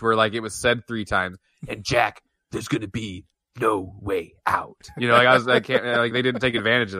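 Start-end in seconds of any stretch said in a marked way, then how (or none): 1.7-4.9 low, slow, deliberate promo threat; wrestling promo. Acted. Co-host acting a promo before the match, low and deliberate. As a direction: draw out each word, softer and lower. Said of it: "a promo right before the match".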